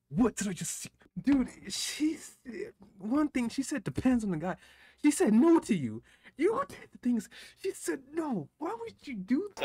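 high, loud indignant protest; outraged disbelief. Real, not acted. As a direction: argue at the screen, appalled. Outraged at refusal shown in clip.

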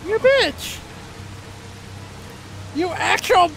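high indignant yell; shouting at the enemy; angry outburst. Real, not acted. Yelling at game enemy.

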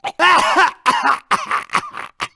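staccato villain laugh; gloating cartoon laughter; evil cackle. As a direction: cackle gleefully in quick bursts.

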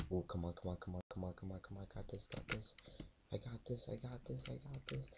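quiet muttered self-encouragement; psyching myself up. Real, not acted. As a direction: hype yourself up under your breath. Muttering to himself mid-game.

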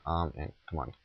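ordinary delivery

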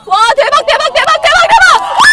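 building from loud to screaming high; excited cheering. Real, not acted. Cheering the first-place winner.

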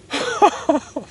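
high, giddy giggle; gleeful laugh. Real, not acted. Angler framing his prize fish, high gleeful laugh. Setting up photo with catch.

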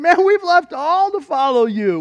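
loud, high-pitched protest; Peter's bold claim. Acted. Peter to Jesus about leaving all, bold and loud. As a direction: call it out boldly as the disciple. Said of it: "Peter began saying".

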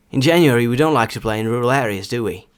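example sentence at normal speed, run together; стандартна швидкість. Shown as a demonstration. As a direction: read it fluently at everyday pace. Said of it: "а потім вже зі стандартною швидкістю". (зв'язно, у звичайному темпі)

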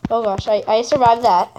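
ordinary talk

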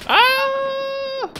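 loud, high sudden cry of alarm; startled scream. Real, not acted. Gamer nearly killed, high startled scream. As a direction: yelp as the shot hits.

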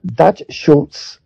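ordinary delivery

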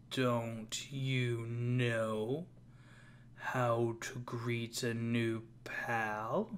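slow, soft, menacing stranger voice; drawn-out creepy greeting in the dark; low and quiet, each word stretched out. Acted. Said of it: "That sounds menacingly, menacing".

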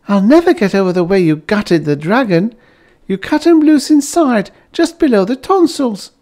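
a wife thrilled with her new home; gushing Bliss. A narrator's reading. Bliss admires husband.

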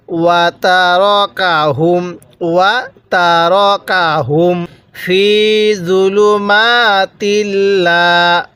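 slow, drawn-out tajweed chanting; teacher reciting slowly for students to copy; Quran recitation. Shown as a demonstration. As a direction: recite each syllable long and even. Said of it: "আমি যেভাবে উচ্চারণ করব".

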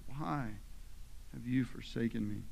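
low, soft, broken by a pause; hushed anguish. A narrator's reading. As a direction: ask it quietly, pausing after "why". Retells the forsaken question, hushed.

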